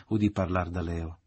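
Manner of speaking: usual speaking voice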